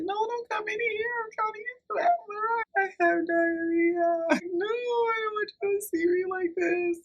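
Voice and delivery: very high, crying wail. Retold or imitated. Crying from the bathroom at the people outside, very high and wailing. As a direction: sob it out, high and broken. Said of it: "kinda wail".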